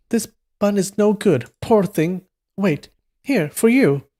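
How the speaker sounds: high, light youthful voice; young man voice. Acted. Reader corrects the voice to the young man, high. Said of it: "that's the young man talking".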